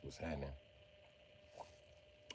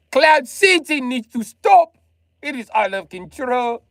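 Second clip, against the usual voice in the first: mock proclamation; 10 semitones higher, 5 dB louder, slower than usual. High, slow loud demand.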